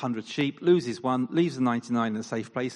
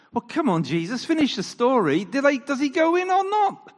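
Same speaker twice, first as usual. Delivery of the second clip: much higher and louder, pleading; mock exasperation; 12 semitones higher, 6 dB louder than usual. Preacher pretending to beg Jesus to finish the story. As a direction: playfully badger Jesus to finish.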